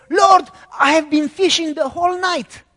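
high, loud exasperated fisherman; Peter protesting loudly. Acted. Peter protests to Jesus after fishing all night, loud and exasperated.

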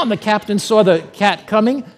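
ordinary talk, nothing marked

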